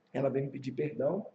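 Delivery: ordinary talk, nothing marked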